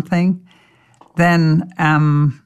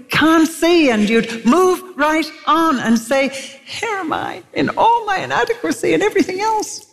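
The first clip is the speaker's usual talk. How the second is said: much higher, pleading sermon voice; impassioned preaching; 8 semitones higher than usual. Acted. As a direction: plead with the congregation.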